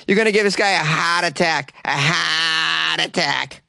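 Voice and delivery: heart attack as hahd attack in Boston accent. Shown as a demonstration. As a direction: drawl heart attack Boston style, slow. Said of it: "word to say with a Boston accent".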